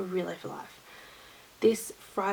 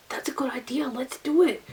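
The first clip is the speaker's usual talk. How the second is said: higher, keen retold reaction; eager co-worker; 5 semitones higher than usual. Co-worker backs the head-shave idea, eagerly. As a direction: quote an eager yes with lifted pitch.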